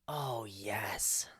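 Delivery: soft whispered savouring; hushed relish. Pretend. Listener relishing the cigarette being lit, hushed. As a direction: whisper it with mock delight.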